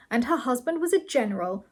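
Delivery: ordinary delivery, nothing marked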